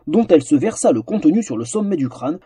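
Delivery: usual speaking voice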